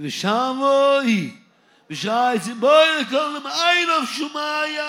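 high, loud cry to the Creator; crying out to heaven. Acted. Preacher crying out to God with the congregation, high loud call.

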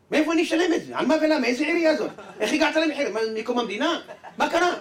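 loud, high, frantic yelling; panicked angry shout. Acted. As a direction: shout as if handed a huge bill. Man thinks it is a bill. (צעקה גבוהה ומבוהלת)

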